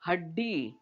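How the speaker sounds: हड्डी as 'had-dee' with a held ड; half consonant. Shown as a demonstration. Teacher modelling the word for children, हड्डी as 'had-dee'. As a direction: stop on the half ड, then release डी. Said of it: "इस ड के नीचे हल चिन्ह लगा है".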